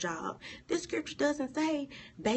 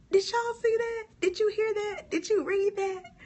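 much higher, louder excited questions to the viewers; excited calling out; 13 semitones higher, 6 dB louder than usual. Real, not acted. Preacher thrilled, asking her viewers if they saw it.

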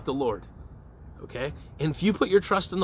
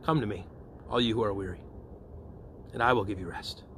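low, soft, unhurried reassurance with pauses; gentle invitation; 6 semitones lower, 7 dB quieter than usual. Acted. Holy Spirit inviting the weary to trust, gently and low. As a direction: invite the weary softly, in a lowered voice. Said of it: "the Holy Spirit invites us to trust".

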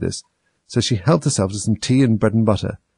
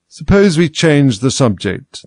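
slow, stretched yawn-talk, raised pitch; 7 semitones higher, 7 dB louder, slower than usual. A narrator's reading. March Hare interrupting out of boredom, yawning. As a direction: draw the words out through a yawn.

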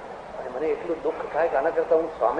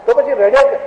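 insistent question; 8 semitones higher, 6 dB louder than usual. Retold or imitated. Repeated louder.

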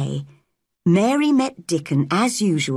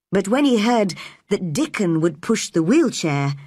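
usual speaking voice

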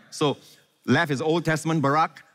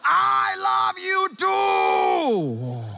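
yelled reply; much slower than usual. Pretend. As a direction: shout back your love across the garden. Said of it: "Lovers don't yell".

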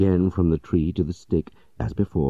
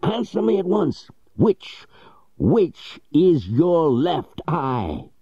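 high furious demand, slowing at the end; angry shouting; slower than usual. Acted. Legrand seizing Jupiter by the collar, shrill furious demand. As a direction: yell it, drawing out which is your left eye. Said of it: "he shouted".

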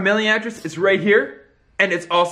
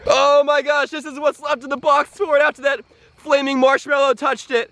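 ordinary talk, nothing marked

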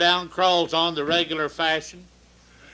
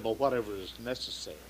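fast and softer, a hurried yes; quick soft giving in; 9 semitones lower, 11 dB quieter, faster than usual. Acted. Waitress to the doctor, giving in fast and soft. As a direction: rush it out under your breath.

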